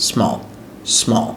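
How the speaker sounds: Small in one quick go; short, quick small without holding the s; said quickly. Shown as a demonstration. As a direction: run the s straight into the rest of small. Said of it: "দ্রুত বলতে পারবেন".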